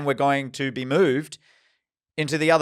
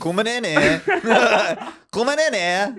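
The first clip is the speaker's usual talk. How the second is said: mock Scouse accent; 9 semitones higher, 3 dB louder, slower than usual. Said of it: "I don't know what accent I'm doing".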